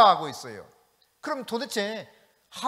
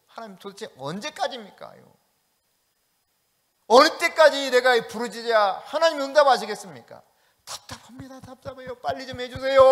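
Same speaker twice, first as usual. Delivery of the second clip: exasperated plea; 4 semitones higher, 5 dB louder than usual. Retold or imitated. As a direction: beg God to hurry, fed up. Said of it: "그런 질문 하나님께 해보셨죠". (답답한 신자가 하나님께 빨리 해달라고 조르듯)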